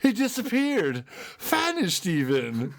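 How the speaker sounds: very high, drawn-out cartoon-cat voice; slow, hammed-up cartoon exit line; Snagglepuss. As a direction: stretch each catchphrase out theatrically, way up high. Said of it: "goes right into Snagglepuss".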